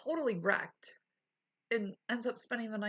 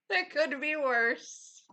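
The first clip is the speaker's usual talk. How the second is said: still giggling; 6 semitones higher, 3 dB louder, slower than usual. Real, not acted. Still laughing at chat.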